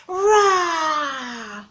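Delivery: loud fearsome roar to copy; dragon roar. Shown as a demonstration. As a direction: roar out for the class. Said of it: "let's hear you, children".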